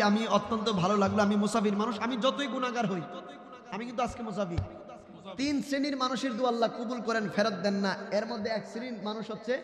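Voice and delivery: ordinary talk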